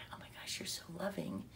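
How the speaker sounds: quick, amazed whisper; hushed admiration. Retold or imitated. Praising the woman at the door, hushed admiration.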